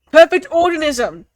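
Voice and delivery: normal talking voice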